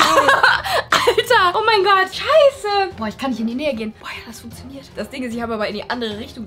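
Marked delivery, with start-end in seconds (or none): 0.0-2.9 shrieking jackpot winner. Real, not acted. Still reacting to the pepper spray.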